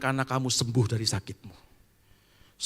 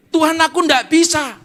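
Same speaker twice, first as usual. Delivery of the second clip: whiny protest; 12 dB louder than usual. Acted. Believer protests to God.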